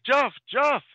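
high, loud mock call across the street. Father hailing his son from across the street, mock calling out. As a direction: shout the name as if hailing someone. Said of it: "So he calls out".

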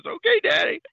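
put-on very high, loud kid squeal; imitated gleeful boy. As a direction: chirp it like a delighted child, hammed up. Imitates boy's gleeful obedient reply.

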